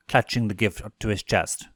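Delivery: usual speaking voice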